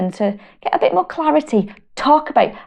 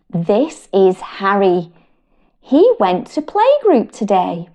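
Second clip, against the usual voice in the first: slow, sing-song picture book reading; reading to children; 2 semitones higher, slower than usual. Acted. Mum reading a feelings book to her children, slow and sing-song. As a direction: draw little listeners into the story.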